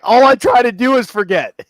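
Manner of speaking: high, very loud acted cry; loud outburst. Altar boy answering never forget, loud high outburst. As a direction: burst out at the top of the voice.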